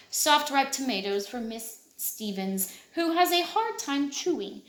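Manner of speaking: ordinary delivery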